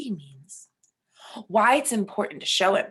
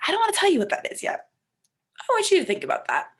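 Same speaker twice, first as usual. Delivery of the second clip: playful rushed aside; 9 semitones higher, faster than usual. Acted.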